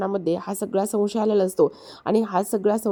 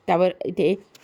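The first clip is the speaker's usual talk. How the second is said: ordinary talk, nothing marked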